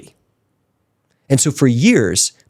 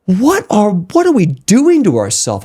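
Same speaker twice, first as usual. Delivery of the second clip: higher and louder, half-laughing disbelief; amazed outburst; 9 semitones higher, 4 dB louder, slower than usual. Retold or imitated. Meditating alone, he bursts out in amazed laughter. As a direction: marvel at it out loud. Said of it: "I go".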